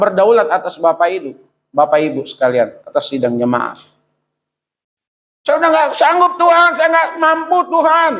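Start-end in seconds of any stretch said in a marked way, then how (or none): 5.5-8.1 high-pitched, sustained desperate plea; acted cry to God. Believer telling God they cannot cope, crying out. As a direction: call out as if at the end of your strength. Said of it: "Teriak juga nggak apa-apa".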